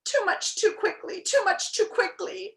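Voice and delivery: high, strained wheeze between gulps; wheezed. A narrator's reading. Overfed bug agreeing between gulps, high wheeze. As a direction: wheeze it out in uncomfortable bursts. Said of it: "wheezed the uncomfortable bug between gulps".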